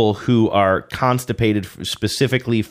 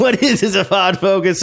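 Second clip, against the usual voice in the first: higher, laughing through the quote; laughing repeat; 9 semitones higher than usual. Retold or imitated. Repeating his cohost's quote, laughing. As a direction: echo the line while cracking up. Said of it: "quoting back, laughing".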